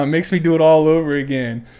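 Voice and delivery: normal talking voice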